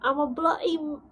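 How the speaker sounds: faked accent drifting from Australian to British. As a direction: try Australian and slip into British. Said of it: "no, that's more British".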